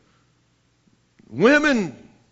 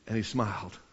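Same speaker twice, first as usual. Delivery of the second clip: hushed; 12 semitones lower, 13 dB quieter than usual. Acted. Captain's smile, hushed climax.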